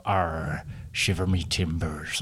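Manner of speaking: low, soft West Country pirate growl; a quiet, hammed-up "Arr" in West Country pirate voice. Podcast host shows what a pirate sounds like, low West Country growl. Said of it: "So you're gonna go".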